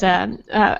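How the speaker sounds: ordinary talk, nothing marked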